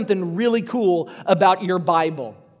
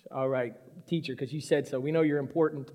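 low, soft grudging compliance; reluctant fishermen; 9 semitones lower, 11 dB quieter than usual. Acted. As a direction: humour the teacher half-heartedly, very quietly. Fishermen give in reluctantly to teacher.

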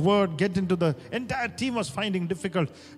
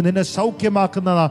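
rapid-fire prayer; 8 dB louder, much faster than usual. Acted. Rapid Malayalam prayer bursts.

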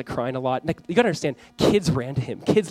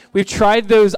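ordinary talk

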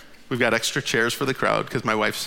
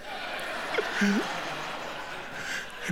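an airy, voiceless chuckle, high; soft breathy laugh; 6 semitones higher, 10 dB quieter than usual. Real, not acted. Storyteller laughing at his own mishap, airy chuckle. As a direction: laugh it off under your breath.

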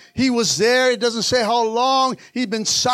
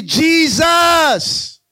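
higher, drawn-out shouted plea; demonstrated shout; 4 semitones higher, 4 dB louder than usual. Shown as a demonstration. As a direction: shout the name out to the congregation. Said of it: "You need to shout".

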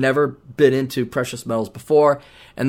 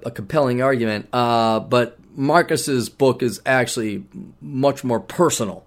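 ordinary talk

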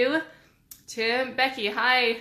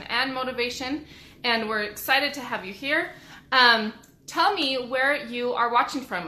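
ordinary delivery

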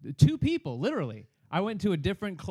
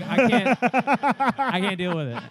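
fed up; 5 semitones higher, 7 dB louder than usual. Retold or imitated. Exasperated teller.

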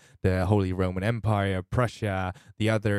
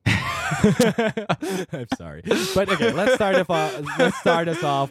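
high, loud laughing speech; 10 semitones higher, 5 dB louder, slower than usual. Real, not acted. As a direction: talk through a burst of laughter. Bursts out laughing after joke.

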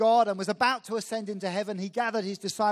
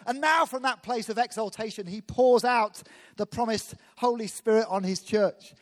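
preaching; 2 semitones higher, 4 dB louder than usual. Acted. Preacher building to exaltation.

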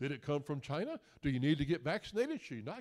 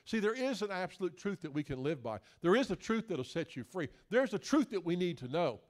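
ordinary talk, nothing marked

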